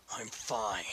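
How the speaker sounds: whispered curt denial; cold dismissal. Acted. Ignatius brushing off his friends, whispered. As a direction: shut them out, barely voiced.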